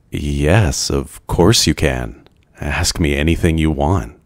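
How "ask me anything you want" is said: ordinary delivery, nothing marked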